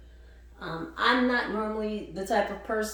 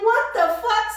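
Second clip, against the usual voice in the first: much higher, loud "What the fuck?"; shocked outburst; 13 semitones higher, 8 dB louder than usual. Retold or imitated. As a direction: blurt it in alarmed disbelief, pitched way up. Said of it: "I'm like".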